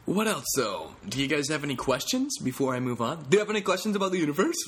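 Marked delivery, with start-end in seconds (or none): 3.3-4.5 high, loud, fast put-on Dutch physicist; Hieronymus voice. Comic previewing his friend's catchphrase, put-on physicist voice. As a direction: rattle it off like a know-it-all scientist.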